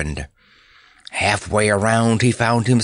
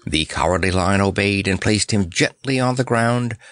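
plain reading voice

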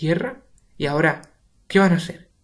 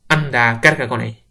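louder scornful; contemptuous; 8 dB louder than usual. Acted. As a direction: sneer loudly. Throwing Pepe at Rosa.

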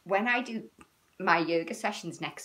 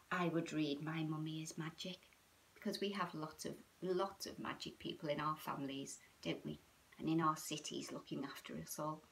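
softer, intimate lead-in to the story; soft hushed; 3 semitones lower, 9 dB quieter than usual. Real, not acted. Settling the children before reading, softer. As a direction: hush them down for storytime.

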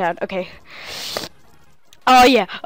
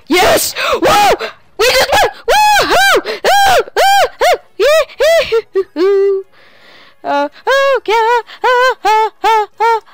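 very high, loud ecstatic shouting; victory screams; 14 semitones higher, 6 dB louder, slower than usual. Real, not acted. Player winning the final battle, ecstatic high screaming. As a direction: scream with joy at the win.

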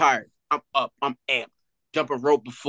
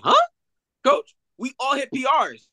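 baffled disbelief; 11 semitones higher than usual. Retold or imitated. Player baffled by coach's verdict.

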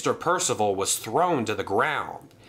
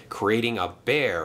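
ordinary delivery, nothing marked

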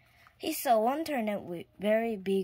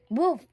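a higher, louder mock woof; dog bark; 6 semitones higher, 6 dB louder than usual. A narrator's reading. The farmer's dog barking as it races into the field. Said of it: "barked the farmer's dog".

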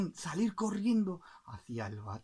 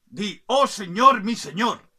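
loud, higher dictated order; commanding king; 6 semitones higher, 14 dB louder than usual. Acted. King orders the bird to pay homage, loud command. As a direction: dictate the homage phrase forcefully. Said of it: "Y el rey, viéndolo, dijo".

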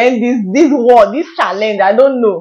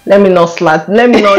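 usual speaking voice

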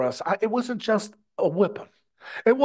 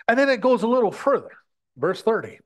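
usual speaking voice

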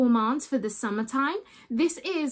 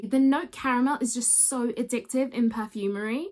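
ordinary delivery

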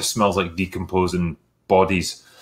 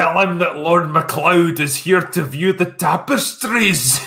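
hammed-up bad Scottish accent, higher and slow; mock a terrible Scottish lord accent, drawn out; 9 semitones higher than usual. Indy fakes a Scottish lord to the butler, in a bad Scottish accent. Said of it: "the worst Scottish accent I've ever heard".

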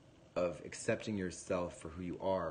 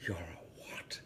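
whispered, disbelieving mum; stunned whisper; 3 semitones lower, 7 dB quieter than usual. Retold or imitated. Belfast mum hearing her son come out, stunned whisper. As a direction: breathe it out in shock. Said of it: "she's like".

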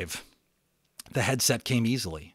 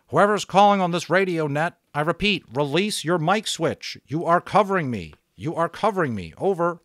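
higher, louder clipped radio procedure voice; radio operator; 7 semitones higher, 6 dB louder than usual. Acted. Radio operator telling an unknown caller to release the switch, brisk. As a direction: sound like a pilot on the net.

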